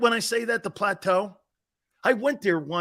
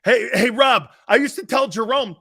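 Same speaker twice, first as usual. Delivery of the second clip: normal talking voice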